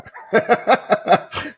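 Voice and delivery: a real laugh, high and breathless, as tears threaten; teary laugh. Real, not acted. Host closing the show, laughing to keep from crying. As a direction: laugh through near-tears. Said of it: "And I'm trying not to cry".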